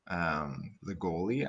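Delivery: ordinary talk, nothing marked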